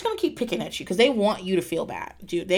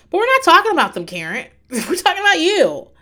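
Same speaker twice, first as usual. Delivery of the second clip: loud, higher, exasperated callout; calling out Karen; 7 semitones higher, 6 dB louder than usual. Real, not acted. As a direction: snap at the TV to set her straight. Scolding Karen on the show.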